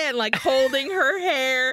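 ordinary delivery

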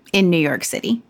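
usual speaking voice

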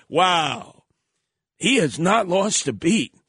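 ordinary talk

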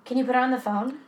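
lightly acted emergency dispatcher; a hint of level, businesslike call-taker; monotone dispatcher. Co-host playing dispatcher to a caller, mildly monotone. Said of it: "I did my best monotone voice".

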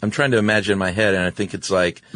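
ordinary talk, nothing marked

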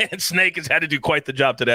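ordinary talk, nothing marked

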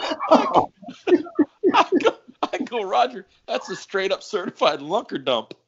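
high-pitched, barely holding in laughter; real laughing fit pushing the voice up; talking through laughter. Real, not acted. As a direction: deliver the punchline while cracking up.

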